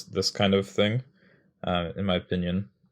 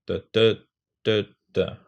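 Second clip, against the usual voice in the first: da-da, louder and rising, then dropping; rhythm syllables; 3 dB louder, slower than usual. Shown as a demonstration. Teacher trying the rhythm another way. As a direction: beat out da-da with a push. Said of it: "Or like".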